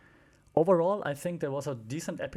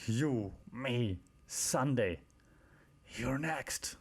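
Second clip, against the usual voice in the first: menacing wrestler threat; 3 dB quieter, much slower than usual. Retold or imitated.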